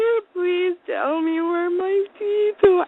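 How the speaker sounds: high, slow, mock-tearful plea; bawling like a baby. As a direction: beg through hammed-up crying. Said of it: "I'm bawling like a baby".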